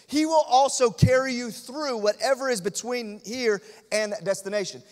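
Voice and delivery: usual speaking voice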